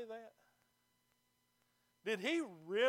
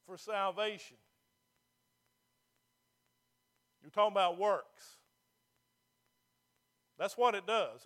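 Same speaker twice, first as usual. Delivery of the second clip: louder, slow, emphatic preaching; 8 dB louder than usual. Acted. Pastor warning the congregation about works, emphatic loud preaching. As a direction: hammer each word at the pews. Preacher pressing his point hard.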